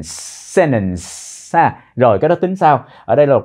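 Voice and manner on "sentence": "sentence" said "sennence", soft; "sentence" đọc là "sennence"; T dropped in sentence. Shown as a demonstration. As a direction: drop the T in "sentence" quietly. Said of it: "các bạn đọc là sentence".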